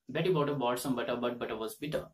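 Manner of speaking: "Betty bought a, bought some butter" rattled off fast; fast twister. Shown as a demonstration. Teacher rattling off the Betty twister at speed. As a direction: run the whole twister quickly. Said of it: "So you can pronounce this word".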